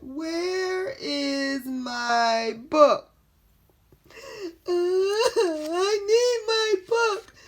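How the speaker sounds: very high, loud, slow wailing; crying. Acted. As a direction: sob for the lost book. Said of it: "Now he's crying".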